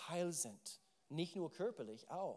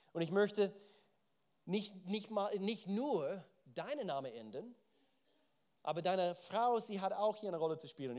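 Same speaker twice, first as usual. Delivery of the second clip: scripture reading; 5 semitones higher, 6 dB louder than usual. Acted. Reads God's words about Sarah.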